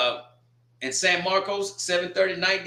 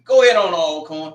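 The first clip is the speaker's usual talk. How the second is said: loud cheer; 9 dB louder than usual. Real, not acted. Cheering on Alcorn's home game, a loud shout. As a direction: shout it out, much louder, cheering.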